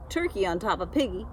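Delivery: usual speaking voice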